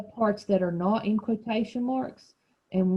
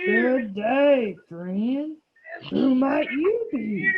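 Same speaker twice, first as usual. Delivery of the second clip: slow, raised growly big-cat voice; leopard voice; 5 semitones higher, 4 dB louder, much slower than usual. Shown as a demonstration. Teacher leading children reading as leopard, slow growly voice. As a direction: growl it out slowly for the group to follow. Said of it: "Get your leopard voice ready".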